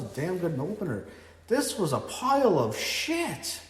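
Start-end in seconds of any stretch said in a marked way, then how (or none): 1.5-3.6 higher, slow, drawn-out disgust; disgusted rant. Real, not acted. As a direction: spit each word out slowly in disgust. Ranting about the main event.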